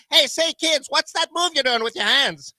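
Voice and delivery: put-on old-timey newsreel reporter asking kids; lightly voiced 1930s radio host; 1930s announcer voice. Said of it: "in my nineteen thirties voice".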